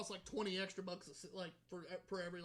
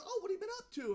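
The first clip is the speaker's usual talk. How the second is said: high-pitched grandma small talk; imitated grandma; 12 semitones higher, 3 dB louder, faster than usual. Grandma asks her grandson what's new, imitated in a high voice. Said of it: "she goes".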